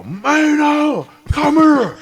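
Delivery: high, put-on voice calling out; yelling a name. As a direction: holler for the kid. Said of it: "he would yell".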